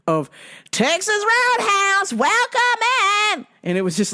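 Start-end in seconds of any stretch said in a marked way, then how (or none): 0.7-3.5 very high, slow drawn-out holler; looping tape shout. Retold or imitated. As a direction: imitate a screamed restaurant welcome tape. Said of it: "a looping tape".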